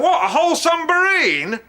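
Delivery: high-pitched, hammed-up disbelief; incredulous phone call. Voicing a Russian on the phone in a film retelling, mock incredulous. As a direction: act out an astonished man on the phone. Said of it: "he's on the phone going".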